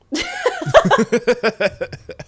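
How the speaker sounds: high shrieking laugh; long howl of laughter; loud laughter. Real, not acted. Reacting to poop fact.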